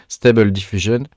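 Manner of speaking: Stable Diffusion in clumsy French-accented English; bad English accent. Shown as a demonstration. Vlogger showing viewers an AI tool, Stable Diffusion in rotten English accent. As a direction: attempt English "STAY-bel dif-YOO-zhun" for Stable Diffusion, French accent showing. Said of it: "avec mon super accent bien pourri".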